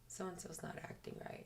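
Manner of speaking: hushed, voiceless whisper. Retold or imitated. Fiance whispering a worry to her. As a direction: whisper it so no one hears.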